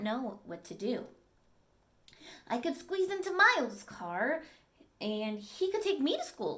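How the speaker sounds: storybook read-aloud, clear and lively; reading to children. Acted. Teacher reading a dog story to the class, lively storytelling. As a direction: read it to the class like a picture book.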